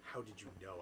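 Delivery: ordinary delivery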